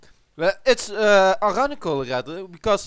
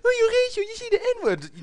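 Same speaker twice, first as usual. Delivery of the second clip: hoge piepstem, mopperende aanklager; squeaky high mock accusation; accuser; faster than usual. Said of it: "So don't come at me with".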